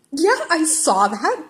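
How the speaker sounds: slow, high, pointed confirmation; drawn-out dry sarcasm; sardonic. Acted. Teacher sardonic.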